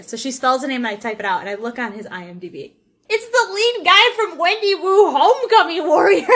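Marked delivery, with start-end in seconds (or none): 3.1-6.3 high, loud fangirl outburst; excited yell. Retold or imitated. Fan re-enacting her office discovery of a film actor, excited yell. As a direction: re-enact yelling a thrilling discovery. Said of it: "I yelled that in the office".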